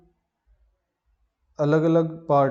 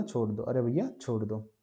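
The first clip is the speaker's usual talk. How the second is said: softer, strained plea in an odd voice; strained after pushing; 3 semitones lower, 6 dB quieter than usual. Retold or imitated. Teacher voicing the dog begging while straining, softer strained plea. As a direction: squeeze it out like you're straining. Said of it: "जोर देने के बाद बोला".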